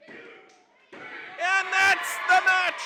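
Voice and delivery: slow, loud, drawn-out call; drawn-out shout. Real, not acted. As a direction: stretch out the finishing call. Calling the finish of match.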